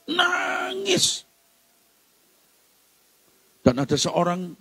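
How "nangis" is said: high, long-held wail of a word; drawn-out emphasis. Acted. Preacher on hard hearts being touched, high drawn-out emphasis. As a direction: stretch it out high. Preacher dramatizes the moved crying.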